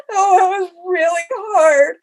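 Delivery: high, loud, drawn-out mock sob; mock crying. Runner retelling her tearful answer to partner, mock crying. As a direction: wail it slowly, imitating your tearful self. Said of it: "I'm in the hotel just crying".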